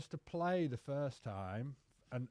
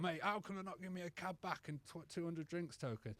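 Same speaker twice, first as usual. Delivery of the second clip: imitating an entitled whinge, softer and higher; obnoxious complaint; 4 semitones higher, 6 dB quieter than usual. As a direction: act out your obnoxious old self complaining. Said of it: "I was so obnoxious".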